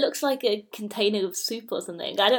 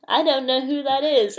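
higher, louder take on a frail character; mock frail voice; 5 semitones higher, 5 dB louder than usual. Co-host adopting Jackie's catchphrase, mock frail voice. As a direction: lightly put on an old character's voice. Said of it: "In a nice, frail voice".

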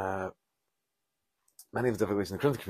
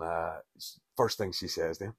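ordinary delivery

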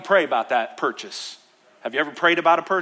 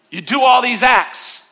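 loud, high, driving preacher's voice; preaching at full force; 6 semitones higher, 5 dB louder than usual. Acted. Pastor preaching to church, loud and fervent. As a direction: preach it hard to the congregation.

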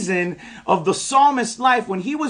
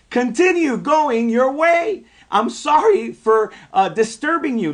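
amazed retelling; 6 semitones higher than usual. Retold or imitated. Preacher retelling the officer's surprising permission to the congregation, amazed.

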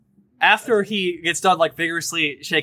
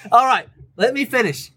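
boisterous captain; 4 semitones higher, faster than usual. Acted. GM greeting the new crew as a brash high-voiced captain. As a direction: bellow a welcome as a brash captain.

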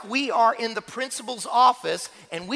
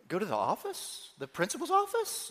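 put-on timid, bewildered child; 9 dB quieter than usual. First grader checks the teacher's order to go to the office, timid and bewildered. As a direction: imitate a small boy querying it meekly.

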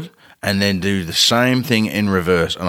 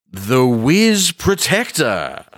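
slow, raised-pitch announcement; grand product reveal; 4 semitones higher, much slower than usual. Acted. Inventor unveils his device to the co-host, slow grand announcement.

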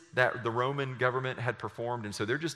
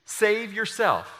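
soldiers mocking; 8 semitones higher, 6 dB louder than usual. A narrator's reading. Soldiers jeer at Jesus, loud high mockery. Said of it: "The soldiers also mocked him".

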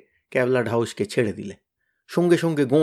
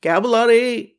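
high, loud wailing calls of despair; anguished wail; 9 semitones higher, 5 dB louder, slower than usual. Acted. Tenida dangling from the kite calls his friends, anguished wail. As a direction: cry out each name in terror. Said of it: "তার আর্তনাদ শোনা যাচ্ছে".

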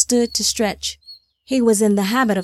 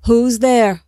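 louder, sudden challenge; calling out a challenge; 2 semitones higher, 6 dB louder than usual. A narrator's reading. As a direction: demand to know who has walked in. Old king challenges unknown intruder.

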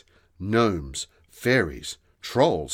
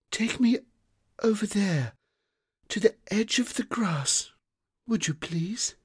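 weak fairy; 14 semitones higher than usual. A narrator's reading. Fading fairy answers the children, high and frail. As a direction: speak like a fading little fairy.

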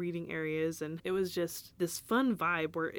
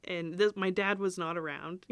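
normal talking voice

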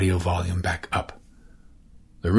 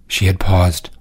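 ordinary delivery

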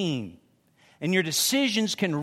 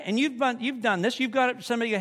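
ordinary talk, nothing marked

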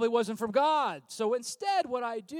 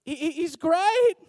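stammer, then GREAT stretched high and loud; insincere praise inflection; 7 semitones higher, 9 dB louder, slower than usual. Retold or imitated. Reference lying through their teeth, stammered high-pitched GREAT. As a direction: push GREAT up high like you don't mean it. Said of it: "listen for the inflection in the voice".

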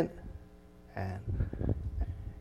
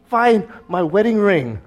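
high, loud, drawn-out plea to heaven; hammed-up retold frantic praying, stretched words; desperate prayer; 10 semitones higher, 5 dB louder, slower than usual. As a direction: beg God urgently for help. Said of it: "I'm praying".

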